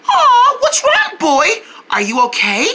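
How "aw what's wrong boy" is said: high, slow sympathetic coo; drawn-out, high "aw" of sympathy; cooing concern. Acted. Concern for the lost puppy.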